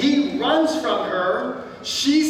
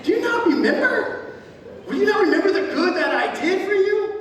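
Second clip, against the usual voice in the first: indignant outburst; 7 semitones higher than usual. Retold or imitated. Preacher imagines confronting the forgetful cupbearer, mock outraged. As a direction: demand how he could forget. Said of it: "I'm like".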